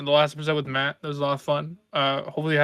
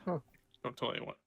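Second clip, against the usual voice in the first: hushed; 2 semitones lower, 16 dB quieter, slower than usual. Pretend. Joking secret to audience.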